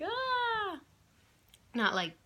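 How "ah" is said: very high delighted grandma aww; doting coo. Retold or imitated. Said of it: "She's like".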